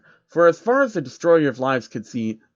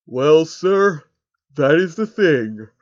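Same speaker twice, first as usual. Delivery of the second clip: louder, distinct voice, slow second half; 3 semitones higher, 5 dB louder, much slower than usual. Acted. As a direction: break bad news to a boss carefully. L character voice.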